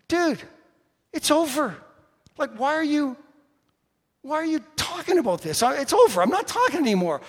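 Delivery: high, loud retelling of an argument; exasperated confrontation. Retold or imitated. Retelling his own angry confrontation.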